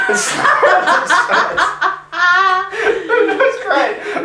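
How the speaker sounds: high, slow words through laughter; can barely speak for laughing; laughing hard. Real, not acted. Telling a friend the tram story, helpless laughter.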